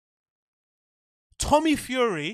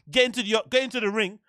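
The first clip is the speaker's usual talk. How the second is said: usual speaking voice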